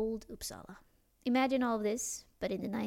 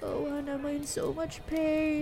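put-on drunk whining; 6 semitones higher, slower than usual. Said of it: "trying to talk to you of".

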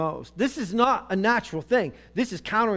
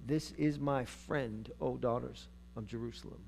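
soft, low love-poem recital; quiet, reverent reading of a bride's words; tender scripture reading; 5 semitones lower, 9 dB quieter than usual. Acted. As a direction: let the praise settle into a hushed close. Reads the bride's love poem aloud.

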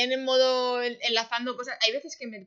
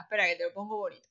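quick aside; 3 semitones lower, 4 dB quieter, faster than usual. Retold or imitated. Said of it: "Y luego digo".

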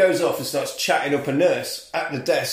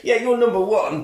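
high, loud boastful brag; bragging; 7 semitones higher, 4 dB louder than usual. Retold or imitated. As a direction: show off to the room. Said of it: "And he's like".

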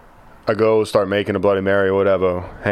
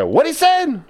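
imitating grandma; slower than usual. Grandson voices grandma baffled at the chicken joke, high squawk.

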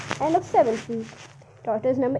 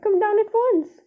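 eager cry; 8 semitones higher, 4 dB louder than usual. A narrator's reading. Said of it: "Miss Silver cried".

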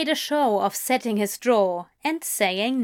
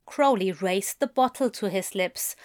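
usual speaking voice

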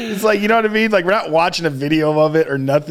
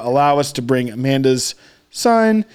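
ordinary delivery

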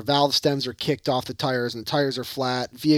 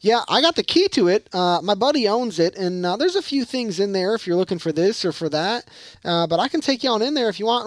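put-on country accent; 7 semitones higher than usual. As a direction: imitate a small-town local with a Southern country accent. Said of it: "That's just my country accent".

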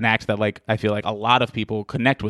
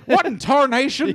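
high, slow hammed-up Texan drawl; mocking his Texan roots, hammed-up Texan accent; 13 semitones higher than usual. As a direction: play a cartoon Texan cowboy. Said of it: "still do it in a Texan accent".

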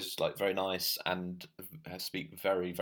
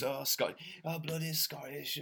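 mock Scottish grumble; 7 semitones higher than usual. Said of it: "I don't know what that accent is".

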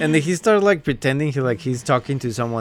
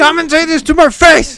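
mock shouting; 13 semitones higher, 8 dB louder than usual. Imitating prank yelling.

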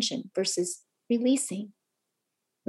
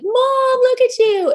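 put-on much higher, louder kid voices poking fun; teasing sons; 7 dB louder, slower than usual. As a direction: play the boys catching mom crying. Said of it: "They're like".

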